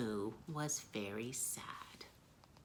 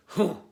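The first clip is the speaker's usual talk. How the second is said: loud dismissive snort; loud scoffing hoo; 4 semitones higher, 11 dB louder than usual. Acted. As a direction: scoff loudly. Lions scoff.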